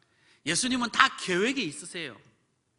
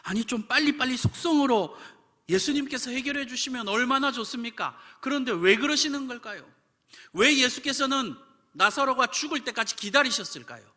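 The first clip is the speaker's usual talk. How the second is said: higher, louder pleading preacher; preaching fervently; 7 semitones higher, 5 dB louder than usual. Acted. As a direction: press the congregation with questions. 설교를 힘껏 외침. (힘차게 묻는 설교)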